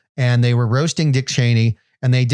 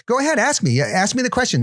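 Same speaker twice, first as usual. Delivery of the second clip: urging Spielberg; 10 semitones higher than usual. Retold or imitated. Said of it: "And then Steven Spielberg".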